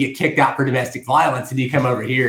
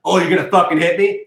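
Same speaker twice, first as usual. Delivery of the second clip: higher, louder imitation of a provoker; mock goading; 6 semitones higher, 5 dB louder than usual. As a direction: dare him to hit you. Acting chick goading a man.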